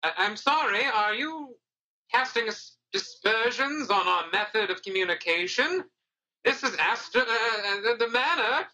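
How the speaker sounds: high, offended kobold voice; indignant manager. Acted. Kobold manager taking offence at the guest, high and indignant.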